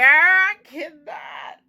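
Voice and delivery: breaking down mid-sentence, loud and slow; crying out. Real, not acted. Vlogger to viewers, scared of dental work, crying out.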